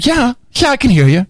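high-pitched mimicry of an irritated reply; mocking imitation, condescending. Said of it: "the intonation of your voice is like".

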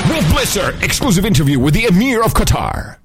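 high, booming promo-style announcement; over-the-top news promo voice; mock TV announcer. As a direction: hype it like a breaking exclusive. Mock announcer intro of TV interview.

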